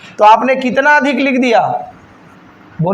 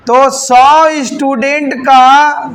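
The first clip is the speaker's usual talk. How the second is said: very slow and louder, word by word; slow dictation; 3 dB louder, much slower than usual. Real, not acted. Teacher dictating the answer to students, slow and firm. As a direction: dictate it so they can write.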